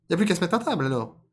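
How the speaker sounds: high, loud character line; eager animal voice. Acted. Rabbit proposing to sit down, high voice.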